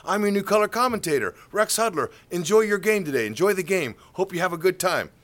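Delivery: high, loud glad-handing greeting; like a politician. Retold or imitated. Handing cards to fans, like a politician. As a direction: work the crowd like a campaigner. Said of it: "like a politician".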